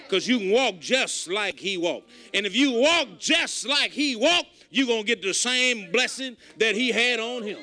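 ordinary talk

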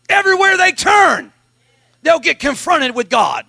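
loud, high preaching at full force; fiery revival preacher voice. Acted. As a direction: preach it out, shouting to the congregation.

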